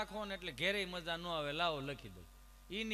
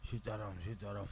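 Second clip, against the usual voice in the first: put-on frail old man; 10 semitones lower, 4 dB quieter than usual. Old man on oxygen answers.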